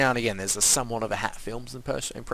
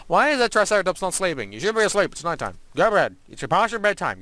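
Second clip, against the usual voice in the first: higher, louder pretend telling-off; mock scolding; 6 semitones higher, 5 dB louder than usual. Player packs the sleepless Triceratops off to bed, mock scolding. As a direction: playfully order a creature to bed, voice raised.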